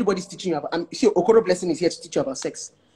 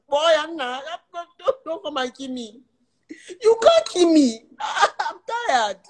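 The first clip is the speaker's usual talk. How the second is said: laughing plea; 9 semitones higher, slower than usual. Real, not acted.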